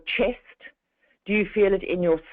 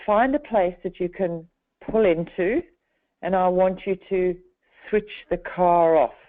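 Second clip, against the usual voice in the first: slower and longer. Retold or imitated. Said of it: "I deliberately lowered or made my words slower and longer".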